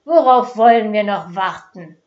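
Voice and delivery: loud, impatient urging; impatient shout. Acted. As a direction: push the crowd to act now. Mob woman urges the attack.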